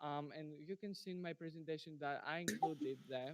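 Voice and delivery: very soft, fading voice; too quiet. Real, not acted. Presenter refers questioner to the presentation, too quiet. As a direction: speak very softly, hard to hear. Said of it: "Can you please speak louder?".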